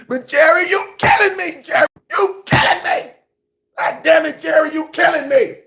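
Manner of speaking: high-pitched wailing complaint; anguished plea. Acted. Fan pleads with team owner, anguished high plea. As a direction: plead as if being tormented.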